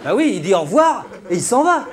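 high, loud spelling-out of the obvious; indignant high-pitched comic yell; mock exasperation. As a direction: shout it like it should be self-evident. Mock outrage at obvious answer.

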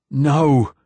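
high loud cry of refusal; cried no. A narrator's reading. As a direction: shout no at the approaching monster. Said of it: "cried Martin Silenus".

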